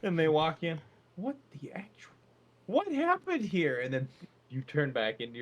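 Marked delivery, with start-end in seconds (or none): 1.2-2.1 shocked whisper. Acted. Nurse walks in shocked. 2.7-3.8 high, startled question; shocked. Acted. Shocked staff.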